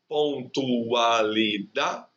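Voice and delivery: Pontualidade said syllable by syllable, very slow; drawn-out teacher demo of Pontualidade; very slow syllable count. Shown as a demonstration. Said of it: "cinco sílabas".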